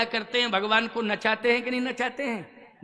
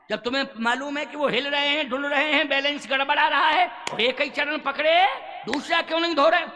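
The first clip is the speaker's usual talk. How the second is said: fast, raised, indignant questioning; indignant scolding; 4 semitones higher, faster than usual. Acted. Lakshman challenging the boatman over his wobbling brother, indignant. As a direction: scold in a quickening, higher voice.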